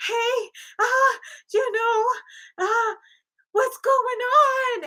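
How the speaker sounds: high, slow crying whine; imitating tearful mistress. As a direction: whimper it out like a jilted lover. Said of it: "the other woman's like".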